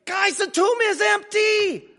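high, loud breathless cry; excited shout. Retold or imitated. Peter and John running back to tell disciples, shouting high. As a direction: burst in shouting the news. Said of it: "come back out of breath, proclaiming".

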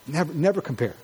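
normal talking voice

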